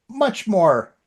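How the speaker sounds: ordinary talk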